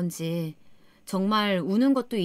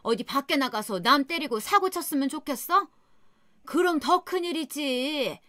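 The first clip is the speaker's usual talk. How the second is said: put-on higher, dismissive lecturing old woman; mother-in-law voice; 6 semitones higher than usual. Mother-in-law telling daughter-in-law to let it go, callous higher voice.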